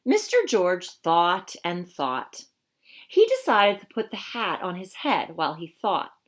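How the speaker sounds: lively, expressive storybook voice; reading to children. Acted. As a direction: read it to a class of young kids. Reads picture book to children.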